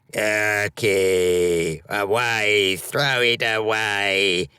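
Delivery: slow creature voice pushing it away; disgusted creature. A narrator's reading. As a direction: shoo it off in a revolted creature's voice. Gold Lock orders him to throw it.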